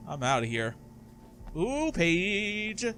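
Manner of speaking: ordinary talk, nothing marked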